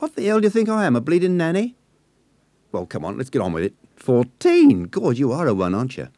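put-on high, loud London girl, half laughing; scandalised Cockney girl. A narrator's reading. Nessie scolds the fourteen-year-old, scandalised. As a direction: scold him, amused despite herself.

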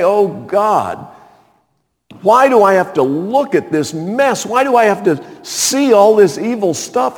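high, exasperated plea to heaven; crying out to God. Acted. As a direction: appeal to God in pained disbelief. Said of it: "you cry out to the Lord".

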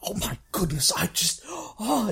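high, breathless whispered gushing; excited whisper. Acted. Scholar spotting rare occult books, excited whisper.

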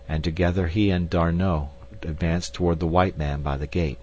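plain reading voice

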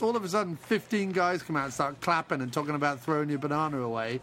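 usual speaking voice

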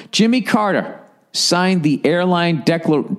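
ordinary delivery

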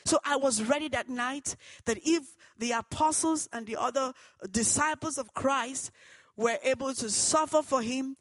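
loud, fervent preaching to the congregation; preaching at full force. Acted. Preacher testifying to the church, loud and fervent. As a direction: drive it home like a preacher in full flow.

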